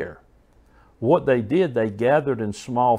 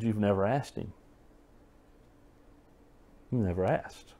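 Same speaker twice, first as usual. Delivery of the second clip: put-on softer, lower, telling the boy gently; soft, gentle answer; 3 semitones lower, 8 dB quieter than usual. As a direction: lean in and say it quietly to the child.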